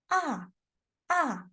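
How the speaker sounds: "ah" falling steeply from high; short, decisive "ah" dropping in pitch; fourth tone. Shown as a demonstration. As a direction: start "ah" high and drop it fast. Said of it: "It's like falling down".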